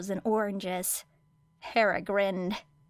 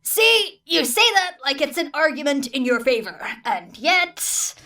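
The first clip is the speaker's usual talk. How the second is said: higher, louder, exasperated; exasperated teacher; 5 semitones higher, 5 dB louder than usual. A narrator's reading. Teacher bickering with Hatsume, exasperated. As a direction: throw up your hands at her.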